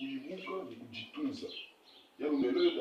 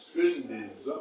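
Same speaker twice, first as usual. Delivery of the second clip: "kusendeza" said much louder as the correct form; corrective stress; 8 dB louder, slower than usual. Shown as a demonstration. Teacher correcting the verb form for students, louder with a rise. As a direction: punch "kusendeza" loud, rising at the end. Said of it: "pas kusendela, mais c'est kusendeza".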